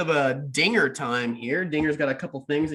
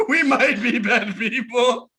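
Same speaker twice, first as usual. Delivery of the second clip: laughing; 4 semitones higher, slower than usual. Real, not acted. Laughing reply.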